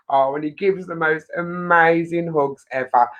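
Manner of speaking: normal talking voice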